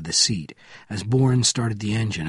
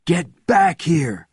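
high, loud, drawn-out command; shouted order; 7 semitones higher, 5 dB louder, much slower than usual. A narrator's reading. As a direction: yell the order, stretching it out. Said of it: "shouted Bourne".

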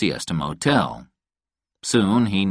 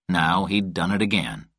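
ordinary delivery

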